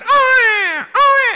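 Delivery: tangisan bayi tiruan, tinggi dan keras. Retold or imitated. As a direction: tirukan oek bayi melengking, keras. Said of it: "Ada anak nangis". (niru bayi nangis banter)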